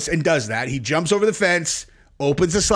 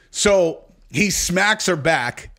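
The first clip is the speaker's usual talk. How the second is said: ordinary delivery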